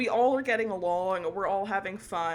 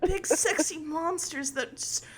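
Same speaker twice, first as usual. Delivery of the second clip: higher, flustered anime hero with a laugh; anime protagonist voice; 8 semitones higher, 4 dB louder than usual. Pretend. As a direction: gush like a flustered anime boy. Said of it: "an anime protagonist voice".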